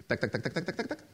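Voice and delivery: rapid tongue-clicked dial noise; tuk-tuk-tuk mechanical clicks; rotary dial clicking. Retold or imitated. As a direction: imitate an old phone dial ticking back round. Imitates a rotary phone dialing.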